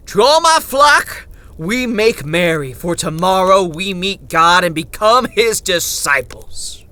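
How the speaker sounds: rousing, high, loud proclamation; Father Goodwill. A narrator's reading. Preacher addresses his flock from his carriage, exultant shout. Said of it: "Father Goodwill shouted to us".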